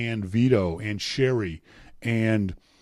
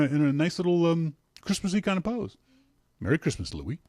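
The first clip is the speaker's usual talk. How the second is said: ordinary delivery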